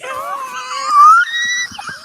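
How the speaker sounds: a huge high shrieking laugh. Real, not acted. Streamer cracking up at the jersey reveal, shrieking laugh. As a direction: crack up in a piercing squeal.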